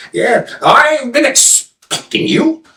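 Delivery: high, slow storybook sage; old seer. Acted. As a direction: draw it out high, like a knowing hermit. Old seer answers the seeker.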